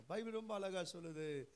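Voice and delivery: normal talking voice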